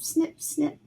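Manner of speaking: plain reading voice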